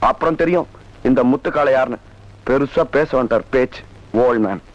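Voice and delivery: mocking. Son mocking his father as he leaves. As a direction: sneer at the old man on the way out.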